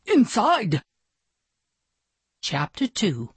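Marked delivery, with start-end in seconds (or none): 0.0-0.8 high, sudden call; urgent mock shout. A narrator's reading. Bellhop ushering the star indoors, high urgent call. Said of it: "shouted Rufus".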